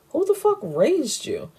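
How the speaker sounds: high-pitched, incredulous scolding; mock outrage, pitched well up; indignant retort. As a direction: act out an appalled father's challenge. Acted quarrel, father scolding son.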